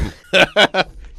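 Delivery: high burst of laughter; laughing. Real, not acted. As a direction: crack up after the silly bit. Cracks up after the dubbing bit.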